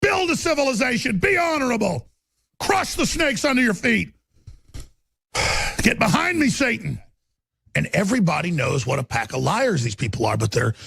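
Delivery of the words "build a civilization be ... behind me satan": high, shouted commands; fierce proclamation in his spirit's voice; spirit's battle cry. Real, not acted. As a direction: roar it like a crusader. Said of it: "my human spirit saying".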